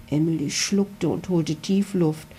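ordinary delivery, nothing marked

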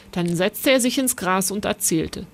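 plain reading voice

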